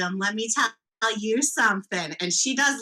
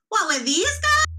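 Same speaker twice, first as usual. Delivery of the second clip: flirty mock surprise; 11 semitones higher, 3 dB louder than usual. Flexing character teasing William.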